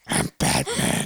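slow, raspy whisper; hushed superhero rasp; Batman. Shown as a demonstration. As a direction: growl it hoarsely as a demo. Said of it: "Say it".

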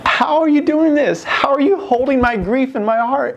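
high, wondering plea to God; awed quote. Retold or imitated. Speaker asking God in awe, high and wondering. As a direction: ask it in amazed disbelief.

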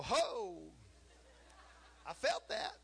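high, loud startled cry with a laugh. Retold or imitated. Someone passes a person with a big spirit, startled and laughing. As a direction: act out being struck by someone's presence. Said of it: "Or you go".

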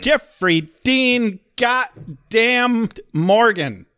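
"Jeffrey Dean goddamn Morgan" drawn out word by word, high; emphatic name drop. Real, not acted. Co-host echoing "Jeffrey Dean goddamn Morgan", slow, relishing emphasis.

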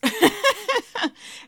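short high burst of laughter; high giggle. Real, not acted. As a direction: laugh lightly at yourself. Laughs after own quote.

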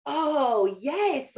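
delighted recognition. Retold or imitated. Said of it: "you're like".

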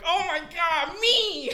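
very high, loud squeal; imitated fangirls. Chicks in the crowd volunteer, mock fangirl scream. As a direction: squeal like a thrilled fan begging to be picked. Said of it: "a lot of chicks were like".

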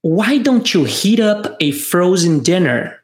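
slow, clear model question; slow repeat-after-me. Shown as a demonstration. Teacher giving a question for students to repeat, slowly. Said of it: "Vamos repetir?".

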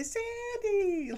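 high, bright call of her daughter's name; delighted greeting. Retold or imitated. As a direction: greet her like a long-lost friend. Mother greeting her happily.

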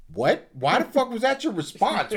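high, loud disbelieving yell; outraged yell. Retold or imitated. Friend reliving his outrage at the guy, loud and high. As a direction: blow up at the absurd answer.

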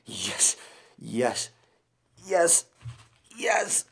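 yes rising to high cries; climax shouting. Acted. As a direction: build to a loud high cry. Climax crescendo.

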